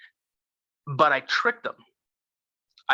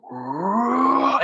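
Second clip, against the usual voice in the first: a sudden high, loud creature roar; imitated monster roar; 8 semitones higher, 4 dB louder than usual. Mimicking the scream that chased him through the woods, roaring. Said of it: "it started out like".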